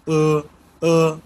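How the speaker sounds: ও as a held "uh", like English "er". Shown as a demonstration. Teacher showing how E sounds mid-word, held "er". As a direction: hold ও steady as an "er" vowel. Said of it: "তখন কিন্তু আর এর মতো উচ্চারণ হয়".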